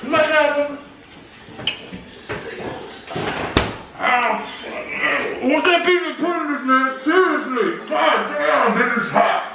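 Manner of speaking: gasping at the spicy heat; burning mouth. Real, not acted. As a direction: mouth on fire from hot sauce. Eating very hot pizza.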